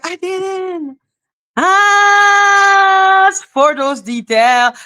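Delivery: exasperated, slow and high-pitched; exasperated retort. Retold or imitated. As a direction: wail the protest, stretching it out.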